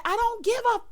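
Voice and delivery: high, loud mock holler; retold high-pitched outburst, cut off. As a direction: imitate an angry yell, voice way up. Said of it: "child, she hollering".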